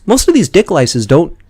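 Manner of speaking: ordinary talk, nothing marked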